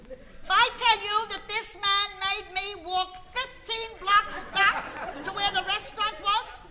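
loud, high comic indignation; exasperated complaint. Acted. Wife telling friend of fifteen-block walk, loudly exasperated. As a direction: build the grievance at full voice.

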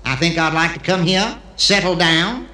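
hammed-up Texan visitor; Texan accent, good-old-boy style. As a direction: play a Texan with a Texas twang. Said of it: "the Texan who comes to Israel".

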